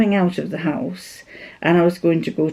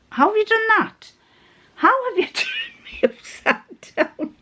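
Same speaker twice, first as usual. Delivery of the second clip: much higher, laughing at the flipped screen; giggling puzzlement at the phone; amused laughing. Real, not acted. Woman laughing at her phone flipping the video upside down, high and amused.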